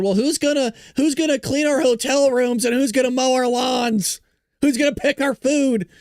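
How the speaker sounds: mocking quote. Host mimicking the same people's complaint about lost workers, mocking.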